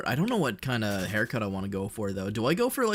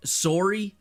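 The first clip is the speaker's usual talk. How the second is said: higher, questioning Canadian "Sorry"; 8 semitones higher, 3 dB louder than usual. Shown as a demonstration. Streamer proving his Canadian accent to chat, demonstrated Canadian "Sorry". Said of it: "I say sorry like a Canadian".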